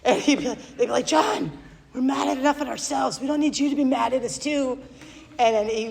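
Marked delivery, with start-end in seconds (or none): 1.1-4.8 high, quick teasing chorus; mocking. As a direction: parody the argument to tease a coworker. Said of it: "they made fun of him".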